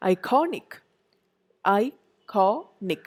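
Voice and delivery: "iconic" stressed on CON; i-CON-ic, middle of "iconic" strongest; penultimate stress. Shown as a demonstration. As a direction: hit CON in "iconic", then break it into syllables. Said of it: "penultimate syllable".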